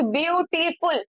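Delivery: Beautiful slowly as beau, ti, ful; little breaks between syllables. Shown as a demonstration. Teacher showing the break in the sound, slow syllabified beautiful. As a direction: put a small break after each syllable of beautiful. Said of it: "little सा break आपको sound में".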